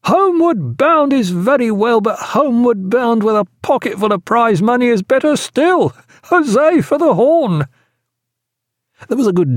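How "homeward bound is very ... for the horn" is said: high-pitched, exuberant tipsy toast; tipsy cry. Acted. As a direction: cry it out high, flushed with wine. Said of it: "cried Wilkins, somewhat flushed with his wine".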